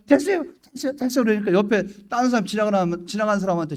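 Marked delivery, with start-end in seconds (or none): 0.1-1.2 high-pitched mock refusal; acted brusque refusal. Church member refusing an offered gift, acted high-voiced brush-off. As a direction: wave off the gift in a raised voice.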